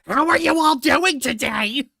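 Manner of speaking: high, loud, manic greeting; unhinged voice. Pretend. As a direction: crank it up like a gleeful maniac. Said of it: "The unhinged vo".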